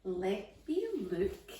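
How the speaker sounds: usual speaking voice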